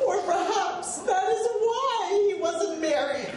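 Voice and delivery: high, loud, drawn-out punchline; comic punchline reading. Acted. Lecturer tops the joke for a laughing audience, comic punchline reading. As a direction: deliver the kicker slowly over the laughter.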